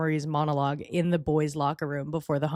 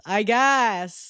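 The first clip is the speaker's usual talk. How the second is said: loud, exasperated repeat; exasperated concession; 3 semitones higher, 7 dB louder than usual. Real, not acted. Commentator reluctantly allowing his late kiss, exasperated. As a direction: burst out with the grudging admission. Same words repeated loudly.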